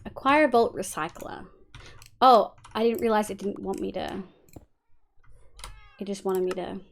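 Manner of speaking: normal talking voice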